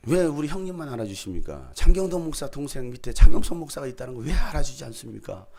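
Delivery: loud playful grievance from the pulpit; joking plea shouted to the audience; mock complaint to the crowd. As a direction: protest loudly, half joking. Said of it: "제가 막 이렇게 얘기했잖아요".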